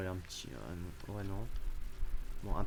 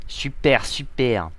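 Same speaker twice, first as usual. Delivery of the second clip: loud sarcastic; 4 semitones higher, 12 dB louder, much slower than usual. Real, not acted. Sarcastic reply to prank reveal.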